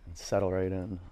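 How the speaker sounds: soft, close relaxation guidance; right in your ear. Acted. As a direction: murmur it gently, right in their ear. Soft guided relaxation cues.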